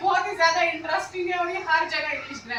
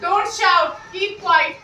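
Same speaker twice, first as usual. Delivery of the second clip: firm, louder classroom commands; stern orders; 5 dB louder, much slower than usual. Shown as a demonstration. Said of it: "ऐसे order दे रहे हो किसी को".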